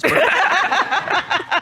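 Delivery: open, high chuckle; hearty laugh. Real, not acted. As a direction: let out a pleased laugh. Laughs after the judge agrees.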